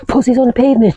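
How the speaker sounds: high, silly mock quote; playful high-pitched quip; joking put-on voice. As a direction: blurt it in a high joke voice. Joke quote after laughing, pitched up.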